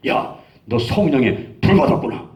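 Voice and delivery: slow, drawn-out pronouncement with weight on 불; retold amazed declaration. Friend grasping his hand after prayer, amazed declaration. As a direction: declare it slowly, as a revelation. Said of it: "한참 보더니만 내 손을 딱 잡더니만".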